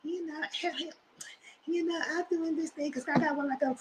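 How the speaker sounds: tiny high, soft squeak of a voice; mock mew-mew pew-pew voice; Sharice. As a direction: imitate her, very high and small. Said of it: "she got the Mew Mew Pew Pew voice".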